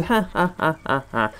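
hollow, spaced-out "ha, ha, ha"; fake laughing. Player joining in as everyone's laughing, fake laugh. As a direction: laugh along unconvincingly, syllable by syllable. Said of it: "Oh, I'm laughing too".

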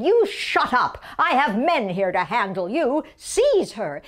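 superintendent's loud, forceful order; angry command. Acted. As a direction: order the guards to seize a prisoner. Superintendent bursts in, orders prisoners seized.

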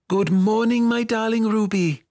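put-on high, singing-out girlish greeting; doting girl. A narrator's reading. Girl greeting her darling dragon, doting and high. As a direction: greet a beloved pet in a high child's voice.

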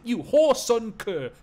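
angry Geordie bruiser, high and loud; big strong Geordie losing his temper; Geordie accent. Acted. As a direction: bellow insults in Geordie. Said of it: "give him a Geordie accent".

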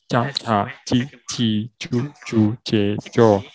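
slow 加卡叽叽啾啾接着, each syllable separate; syllable drill. Shown as a demonstration. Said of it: "就是".

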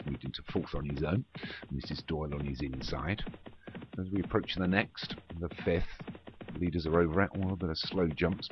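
ordinary talk, nothing marked

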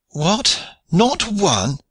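high rising disbelief; dismayed cry. A narrator's reading. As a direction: cry out, stunned. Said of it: "he cried".

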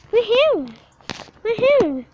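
high, loud whoop; rising and falling loud cheer; celebratory cheer. Pretend. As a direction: whoop it up after a trick. Cheering own tricks.

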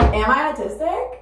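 mock self-quote. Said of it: "And I'm like".

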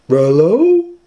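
very high, loud dog-voiced call; Scooby-Doo voice. Scooby calls into the house, very high dog voice. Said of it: "Scooby said".

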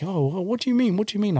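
a touch of alarmed disbelief; puzzled friend. Retold or imitated. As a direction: ask it like a baffled mate. French friend voiced, confused.

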